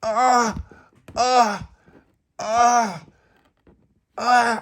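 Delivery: high, loud repeated yelps; acted pain cries. Tony as they pull him, acted pain cries. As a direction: yelp with each pull. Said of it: "they were like that".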